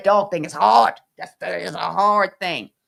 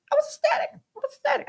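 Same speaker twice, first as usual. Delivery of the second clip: very high, overcome squeal of words; ecstatic; much faster than usual. Retold or imitated. Retells being ecstatic while crying.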